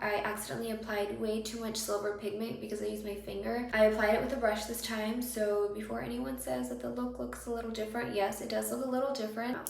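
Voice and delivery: ordinary talk, nothing marked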